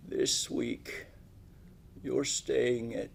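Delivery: drawn out and heartfelt, like a frail elder's confession; old man telling his young guests they matter most; slow old man. Retold or imitated. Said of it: "He talks slow".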